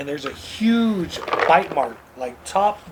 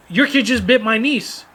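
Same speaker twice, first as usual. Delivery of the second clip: higher-pitched indignant accusation; retold confrontation; 6 semitones higher, 4 dB louder than usual. Retold confrontation with biter's parent.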